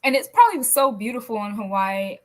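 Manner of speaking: ordinary talk, nothing marked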